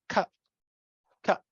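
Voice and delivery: "cut" ending in a catch in the throat instead of T; glottal stop. Shown as a demonstration. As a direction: say "cut" as "cu'", shut off in the throat. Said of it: "just have the glottal stop".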